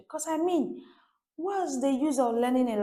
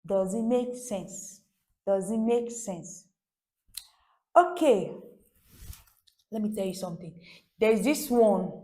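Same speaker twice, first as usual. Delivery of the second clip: normal talking voice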